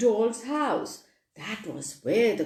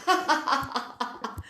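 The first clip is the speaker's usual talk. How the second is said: breathy villain's cackle; sly gloating laugh of a bad bird; wicked laugh; 3 semitones higher, 4 dB quieter than usual. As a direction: laugh wickedly at the angry toys. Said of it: "What a wicked laugh".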